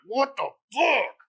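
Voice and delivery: drawn-out, exasperated cry of disbelief; exasperado. Retold or imitated. Acting out his exasperation.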